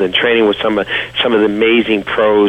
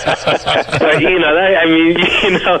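laughing; 8 semitones higher than usual. Real, not acted. Cracking up at own story.

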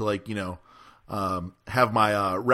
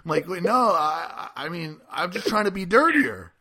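higher, panicky stammer; flustered backpedal; 6 semitones higher than usual. Retold or imitated. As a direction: scramble to take it back. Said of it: "I was like".

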